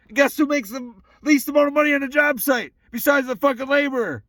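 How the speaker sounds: worked-up, hectoring shout; angry rant. Real, not acted. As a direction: go off on them, yelling and cursing. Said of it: "Feels good to be fucking angry".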